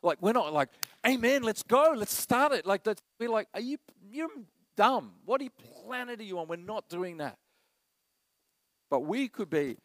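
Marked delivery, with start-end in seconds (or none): 1.0-2.7 loud, high, pumped-up church cheer; hyped-up amen. Acted. Preacher imitating eager worshippers, loud and high. 3.5-6.5 mock scoffing. Mocking scoffer at faith.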